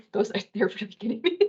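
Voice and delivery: hurried words through a real laugh; laughing, onion-teary. Real, not acted. Cook admitting the onions are getting to her, laughing. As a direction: giggle helplessly while rushing it out.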